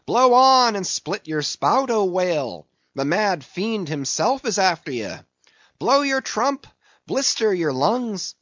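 high, exultant sailor's shouting; high-pitched, fired-up calling out; taunting cry. A narrator's reading. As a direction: hurl the taunt at the fleeing whale. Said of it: "cried Stubb".

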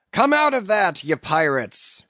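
captain's loud, high roar; a furious sea captain roaring at mutineers. Acted. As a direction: bellow it across the deck. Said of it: "roared the captain".

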